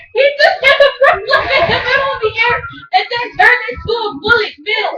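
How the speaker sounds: high, laughing rush of excitement; can't believe what he saw; excited laughing. Real, not acted. Gamer telling viewers about a wild move, laughing and excited.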